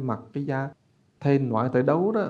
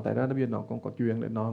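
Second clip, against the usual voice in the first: normal talking voice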